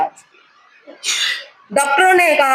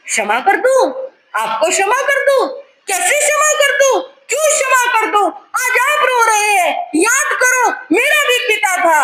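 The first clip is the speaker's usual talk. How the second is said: much higher, crying shout; anguished refusal; 10 semitones higher than usual. Acted. Son refusing his father's plea, crying shout. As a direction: hurl the questions back in anguish.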